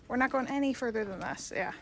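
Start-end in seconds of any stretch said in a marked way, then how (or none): none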